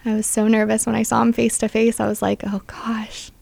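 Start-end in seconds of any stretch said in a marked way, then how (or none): 2.5-3.3 breathless whisper under her breath; whispered gasp. Retold or imitated. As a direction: whisper it as if he might hear you. Nervous, facing her dad.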